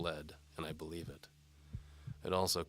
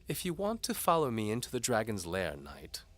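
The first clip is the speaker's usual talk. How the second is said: higher, louder teasing lady voice; seductive noblewoman; 6 semitones higher, 6 dB louder than usual. A narrator's reading. Antimony dares the knight to follow. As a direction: tease the knight like a bold noblewoman.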